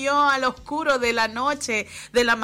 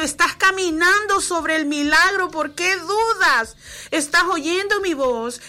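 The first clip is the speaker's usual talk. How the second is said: louder, higher impassioned plea from God; fervent preaching; 6 semitones higher, 4 dB louder than usual. Acted. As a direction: preach it with rising fire. Preaching God's words at force. (predicación fervorosa, más alta)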